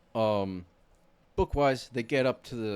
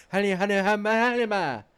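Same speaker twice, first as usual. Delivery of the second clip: hammed-up chant, much higher and louder; mock elvish incantation; 9 semitones higher, 8 dB louder than usual. Retelling the scene, Frodo mock-chanting an elvish spell. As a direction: belt out fake elvish words like a spell. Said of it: "and he goes".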